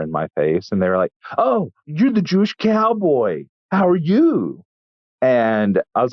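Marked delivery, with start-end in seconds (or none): 1.4-4.6 high, sneering put-on voice; mocking Nazi streamer. Nazi streamer taunting the hot dog vendor, high mocking voice. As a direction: ape his smug greeting, pitched way up. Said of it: "I'm not even doing an impression".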